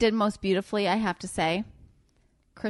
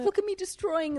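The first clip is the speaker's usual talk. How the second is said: much higher, playful bragging voice; imagined show-off; 12 semitones higher than usual. Pretend. Imagining them bragging about the damage, high mock boast. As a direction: crow it high, like a proud little menace. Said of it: "And then be like".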